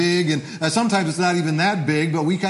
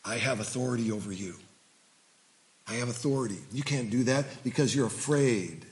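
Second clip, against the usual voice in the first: low, hushed and much softer; voice of fear whispering; 5 semitones lower, 9 dB quieter, faster than usual. Acted. Pastor voicing fear's whisper, low and hushed. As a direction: murmur it like a menacing secret. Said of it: "Fear whispers".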